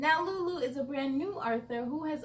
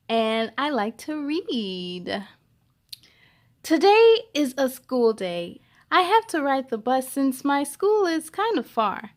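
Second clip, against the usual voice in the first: put-on louder, lively storybook reading for kids; reading to children; 7 dB louder than usual. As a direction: read Esperanza's words out to children. Reads the book aloud.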